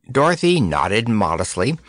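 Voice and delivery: usual speaking voice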